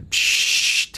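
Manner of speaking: sharp unvoiced psst; mock shushing hiss; imitated shush. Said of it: "Und dann kommt".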